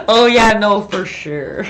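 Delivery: loud Canadian burst, then drawn out; hammed-up, hearty Canadian agreement; Canadian accent. Shown as a demonstration. Painter showing viewers a Canadian saying, hammed-up Canadian accent. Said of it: "that was a Canadian thing".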